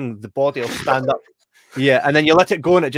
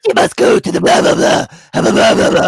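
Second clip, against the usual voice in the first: high, loud hammed-up witch voice; creepy witch; 8 semitones higher, 10 dB louder than usual. Mimicking the witch from the film, high loud hag voice. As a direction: cackle it out like a cave hag.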